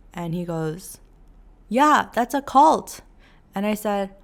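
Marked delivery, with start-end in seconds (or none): 1.7-3.0 slow, louder and higher flat statement; blunt loud verdict. Retold or imitated. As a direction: state it as obvious. Fiance's blunt answer.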